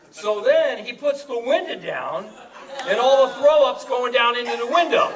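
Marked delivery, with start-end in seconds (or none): none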